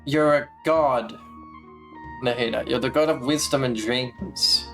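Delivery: usual speaking voice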